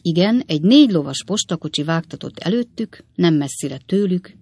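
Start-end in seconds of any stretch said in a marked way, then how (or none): none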